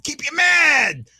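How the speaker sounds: Mad shouted slow and high; mock-villain shout; playful shout. Repeats the line, then louder.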